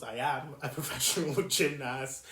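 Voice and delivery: ordinary delivery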